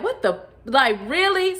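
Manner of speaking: high, loud disbelief; loud high-pitched really; astonished. Retold or imitated. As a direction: jump up in amazement on really. Astonished at friend's reply.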